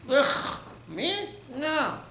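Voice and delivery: squeamish, very high mock refusal; disgusted refusal. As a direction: recoil from the dirty job in a high voice. Acting disgust at carrying sewage.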